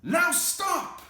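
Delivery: high, loud stern stop; firm command. Acted. Max ordering the wild things to stop, loud command. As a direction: halt the party like a king. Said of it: "Max said".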